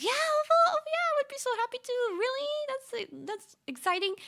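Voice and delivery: very high, eager mimicked reply; imitating Chihaya. Chihaya agreeing to give a ride, high eager imitation. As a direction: play her delighted yes, way up high. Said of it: "She was like".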